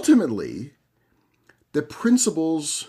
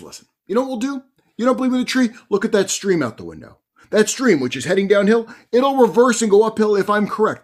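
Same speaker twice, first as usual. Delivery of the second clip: raised, louder challenge in growing frustration; frustrated rabbi; 8 semitones higher, 7 dB louder than usual. Retold or imitated. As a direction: insist on proof, voice pushed up. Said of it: "It's getting more frustrated".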